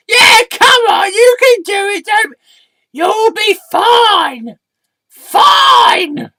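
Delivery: very high, loud drawn-out cheerleading; excitable squeaky alter ego, egging on. Acted. Alter ego urges a reluctant storyteller on, squeaky and loud. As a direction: squeal encouragement in a piercing high voice.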